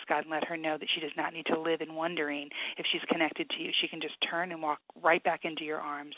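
soft, earnest prayer voice, quiet and steady; praying over a hurting caller; heartfelt prayer. Real, not acted. Author prays for struggling caller, softly earnest.

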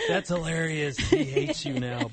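laughing mock comment. Joking aside, laughing.

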